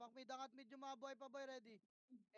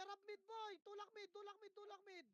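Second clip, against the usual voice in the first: raised, higher shouted callouts to push mid; shouting calls; 6 semitones higher than usual. Real, not acted. Shotcaller to his squad, yelling to push mid. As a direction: yell the push order at teammates. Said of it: "Kailangan ko pa isigaw".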